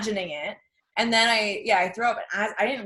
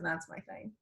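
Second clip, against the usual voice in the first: softer, unvoiced confession; whispered aside; 3 semitones lower, 13 dB quieter than usual. Real, not acted. Confiding her drug of choice to her cohost, whispered. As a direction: whisper it like a secret. Quiet aside about ecstasy.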